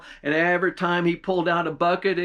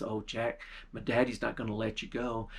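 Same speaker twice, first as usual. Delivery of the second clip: hushed warning; 5 semitones lower, 9 dB quieter than usual. Acted. Girl secretly warns Jack at night.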